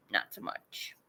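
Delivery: breathy throwaway whisper; whispered aside. Real, not acted. Grower dismissing the male plant, whispering. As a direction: drop to a whisper.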